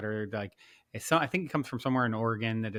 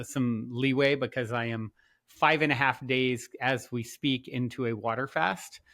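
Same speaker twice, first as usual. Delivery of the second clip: normal talking voice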